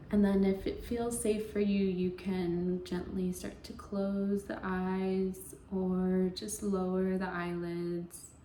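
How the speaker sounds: slow, even meditation voice with long pauses; guided meditation. Acted. Yoga teacher easing listeners into Yoga Nidra, guided meditation voice. Leads a guided relaxation practice.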